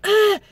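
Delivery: loud, high mock shriek. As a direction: let out a sudden shrill "Ah!" imitating Rachel. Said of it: "Rachel gets all".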